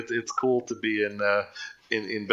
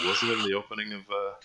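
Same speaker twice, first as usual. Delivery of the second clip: normal talking voice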